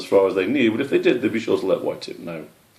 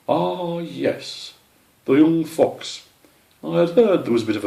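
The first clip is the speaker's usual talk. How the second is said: lightly voiced old owl; 9 semitones higher, 4 dB louder than usual.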